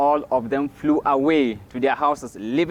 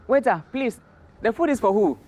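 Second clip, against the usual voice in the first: higher-pitched, lightly acted query; Tortoise questioning waiter; 8 semitones higher than usual. Tortoise asks the waiter about the food, high-pitched acted question. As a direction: ask in a raised character voice.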